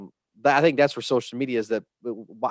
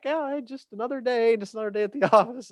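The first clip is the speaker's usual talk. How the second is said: breezy, much higher, fast shrug-off; imitating a carefree colleague, pitched way up; mock nonchalance; 10 semitones higher, faster than usual. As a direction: play it like it's nothing at all. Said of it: "And yet you're just like".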